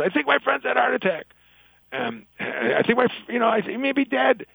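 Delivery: high-pitched, mock-frantic friend on the phone; panicked caller. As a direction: play a panicking emergency caller in a comic joke. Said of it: "the friend panics".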